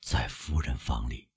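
slow, low, soft mumble; hushed, dazed undertone; murmuring. A narrator's reading. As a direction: murmur it numbly to yourself. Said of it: "他喃喃地说".

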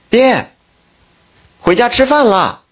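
put-on high young daughter calling her dad home; sweet girl's voice, calling dad to dinner; 女儿叫爹. 大女儿来叫爹吃饭. (高声少女喊爹回家吃饭)

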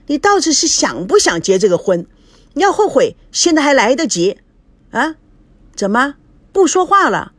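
high, loud, furious tirade at the fiancé; furious scolding. Acted. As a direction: hammer him with angry questions. Bride storms at fiancé.

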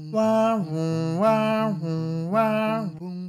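slow wailing mouth siren; ambulance siren. Acted. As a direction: wail it like an ambulance. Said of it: "听到救护车来了".

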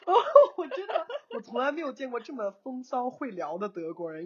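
high, laughing through the words; amused disbelief. Real, not acted. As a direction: break up laughing while telling it. (笑着说，难以置信)

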